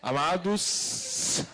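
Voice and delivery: "Amados" very slow, final S held long; drawn-out S. Retold or imitated. Preacher mocking altar speech, drawn-out S on "Amados". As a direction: stretch the S at the end of "Amados" into a long hiss. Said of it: "ainda puxa no S".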